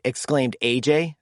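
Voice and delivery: usual speaking voice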